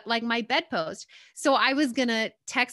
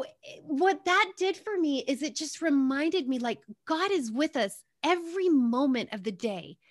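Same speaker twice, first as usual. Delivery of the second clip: usual speaking voice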